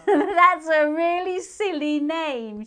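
high, loud, laughing; a child giggling at the name; laughing rudely. A narrator's reading. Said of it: "she said rudely".

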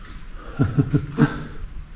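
airy rising chuckle; light laugh. Real, not acted. Spotting a creepy figure in the basement, soft amused laugh. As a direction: laugh quietly at the figure behind. Laughing at guy back there.

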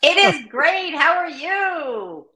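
big, high, loud hello; exuberant greeting. Real, not acted. Guest greets the host on joining the show, exuberant. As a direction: sing out the greeting with long drawn-out words.

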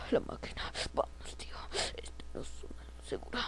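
very soft, voiceless warning; play-acted stealthy hush; urgent whisper. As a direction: whisper to a companion while hiding. Hiding from the machine.

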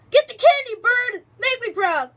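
loud, high-pitched egging on; root for it at full voice; cheering on. Pretend. Urging on the game's bird.

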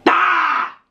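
high-pitched startling "Ah!"; sudden scare shout. Pretend. Host trying to scare a stranger, sudden high shout. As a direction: shout suddenly to make them jump.

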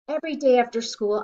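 ordinary delivery, nothing marked

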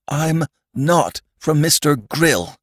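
high, slow plea; pleading. A narrator's reading. Sandman denying that Mister Grill sent him, pleading. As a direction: plead earnestly, word by word. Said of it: "pleaded the Sandman".

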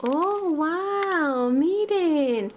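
thrilled reveal of a new toy; excited. Real, not acted. Got the wanted figure.

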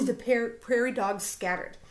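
usual speaking voice

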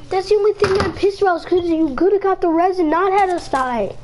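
ordinary talk, nothing marked

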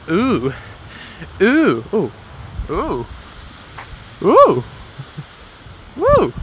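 ooh in rising, hammed-up wonder; impressed oohs. Vlogger wowed by the new sensor, escalating playful oohs.

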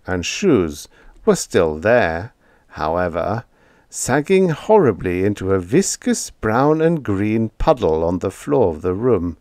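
usual speaking voice

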